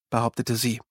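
plain reading voice

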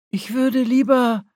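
tentative start. A narrator's reading. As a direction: begin an objection and get cut off. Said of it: "setzte Celestaris an".

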